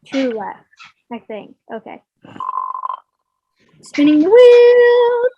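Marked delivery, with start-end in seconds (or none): 3.8-5.3 high, loud, slow announcer; game show host. Acted. Game-show wheel spin.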